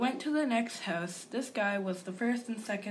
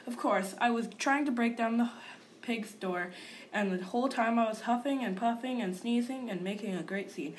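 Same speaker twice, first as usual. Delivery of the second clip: usual speaking voice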